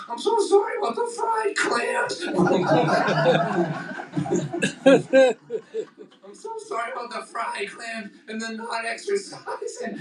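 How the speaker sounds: high, laughing, pleading apology; mock-tearful sorry to his own heart. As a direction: beg the heart's forgiveness through laughter. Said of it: "I'm like".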